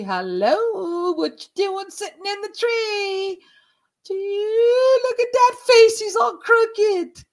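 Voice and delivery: high, loud, drawn-out singsong; playful mock greeting. Teasing the creepy figure in the tree, mock singsong. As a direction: call out teasingly, stretching the words.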